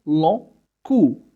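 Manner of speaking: long cou with a nasal on-vowel, slow and careful; correct long. Shown as a demonstration. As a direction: say long cou the proper French way. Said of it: "لا أقول long".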